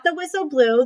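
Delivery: plain reading voice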